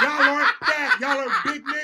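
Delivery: high loud laughing. Real, not acted. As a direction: laugh through the words. Teasing friends, laughing.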